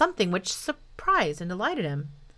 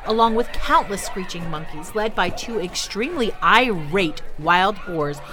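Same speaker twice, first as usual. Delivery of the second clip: ordinary delivery, nothing marked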